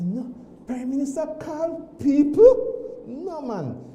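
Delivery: can't believe who is calling; stunned disbelief. Retold or imitated. Citizen answering the phone to the prime minister, stunned and drawn out. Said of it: "I said".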